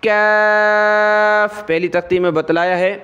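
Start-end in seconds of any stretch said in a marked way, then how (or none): 0.0-1.7 کاف said thin and light, very drawn out; thin kaf. Shown as a demonstration. Teacher showing the small kaf, thin and drawn out. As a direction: keep the کاف thin and stretch it. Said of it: "اس کو باریک کر کے پڑھتے ہیں، پتلا کر کے".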